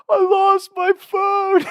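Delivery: put-on high, slow, wailing drunk whine; mock drunk sobbing. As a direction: blubber it like a drunk kid.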